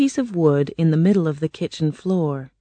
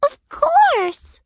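very high squeaky reply; eager Winkie. A narrator's reading. Winkies agreeing to help Dorothy, high and eager. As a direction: agree readily in a tiny high voice. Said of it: "the Winkies replied".

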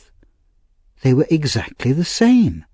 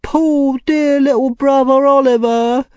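high wailing mock sobs, drawn out; pretend weeping; 3 dB louder than usual. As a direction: fake a loud, high-pitched weep. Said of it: "pretending to weep".

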